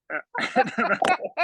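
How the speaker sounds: a high burst of laughter; quick high-pitched laugh; high giggle. Real, not acted. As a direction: laugh at the joke, voice jumping high. Laughing at horses swearing.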